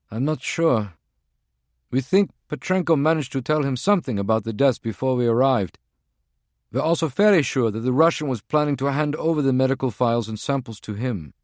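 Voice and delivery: a tense field agent reporting in; agent's voice. Acted. Voices Prague One on phone.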